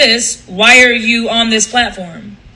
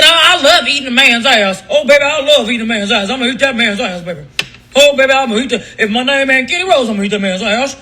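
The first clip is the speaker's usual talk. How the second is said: mocking imitation; faster than usual. Mocking woman's freaky online video.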